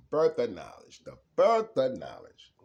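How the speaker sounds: emphatic proclamation. Shown as a demonstration. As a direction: announce it like a revelation.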